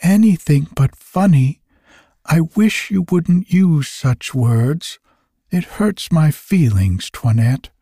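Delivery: a hint of a hurt little elf. A narrator's reading. Elf character's spoken line.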